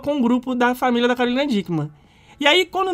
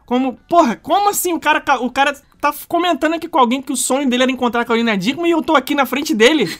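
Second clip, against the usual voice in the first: raised-pitch incredulous question; animated, higher mock astonishment; disbelieving surprise; 3 semitones higher than usual. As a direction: act out someone baffled by what they overheard. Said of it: "como quem dizer assim".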